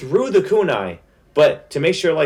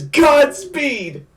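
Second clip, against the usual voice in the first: high, drawn-out attack yell; battle cry; 13 semitones higher, 4 dB louder than usual. Retold or imitated. Fan voicing a fighter springing off the ground, battle cry. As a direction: call out the move like an anime hero.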